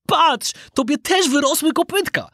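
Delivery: put-on high-pitched, startled boy voice; Knot calling out. A narrator's reading. Schoolfriend pointing out new hooves, calling out. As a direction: exclaim it high to your friend. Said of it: "zawołał Knot do Pinokia".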